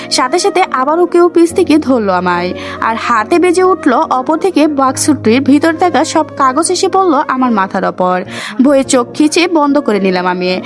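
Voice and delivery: usual speaking voice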